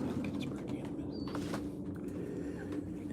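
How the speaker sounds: hushed whisper, slowed down. Real, not acted. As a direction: whisper it slowly, barely voiced. Soft aside to the dog.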